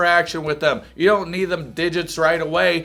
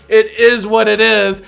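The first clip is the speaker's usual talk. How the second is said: mocking shrug; 5 semitones higher, 7 dB louder than usual. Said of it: "you just don't say".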